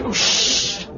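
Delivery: a quick breathy hush to silence a neighbour; whispered shush. Acted. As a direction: hush her under your breath during the talk. Shushing someone during the speech.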